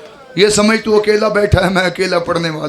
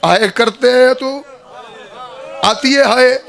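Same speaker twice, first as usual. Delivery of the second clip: weeping; 3 semitones higher than usual. Acted. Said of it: "बीबी ने रो के कहा".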